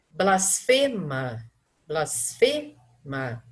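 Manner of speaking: "blasfema" very slow, weight on "fe"; tonic syllable shown. Shown as a demonstration. Teacher marking the last tonic syllable, very slow. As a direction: drag out "blasfema", leaning on "fe". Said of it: "A última sílaba tônica é o fê".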